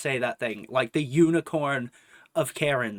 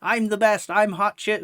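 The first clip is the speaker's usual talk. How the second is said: lightly mocking boastful Karen, higher pitch; mock bragging, a bit raised; screaming braggart Karen; 4 semitones higher, 4 dB louder than usual. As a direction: imitate a self-important rant with blah-blah filler. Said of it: "just scream this shit in public".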